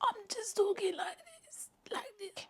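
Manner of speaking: tiny high squeak with no power; voice gone. Shown as a demonstration. Singer showing followers she has no sound, tiny high squeak. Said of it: "have no sound".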